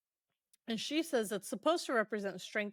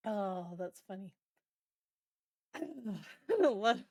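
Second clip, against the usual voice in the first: usual speaking voice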